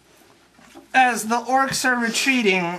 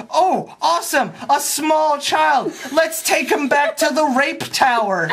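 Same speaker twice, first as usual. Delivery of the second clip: gleeful orcs; 5 semitones higher than usual. Retold or imitated. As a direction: ham up orcs pleased with their prize. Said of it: "And the orcs are like".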